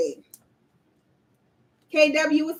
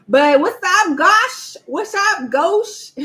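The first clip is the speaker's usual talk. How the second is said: excited greeting; 7 semitones higher, 7 dB louder, slower than usual. Real, not acted. Excited shout-out to a viewer.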